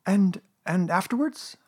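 high, slow, hesitant question; husky, faltering Piglet. A narrator's reading. Piglet finally speaking after Rabbit's plan, hesitant and husky. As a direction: falter into it slowly, pitched high. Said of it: "managed to say very huskily".

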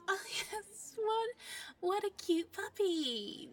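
hammed-up delight; fake cooing. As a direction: coo over the puppy for show. Fake gush for cover.

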